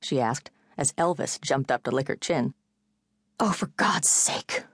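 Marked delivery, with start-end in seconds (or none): 3.4-4.7 slow, voiceless angry mutter. A narrator's reading. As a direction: grumble it under your breath, annoyed. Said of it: "Julia muttered angrily".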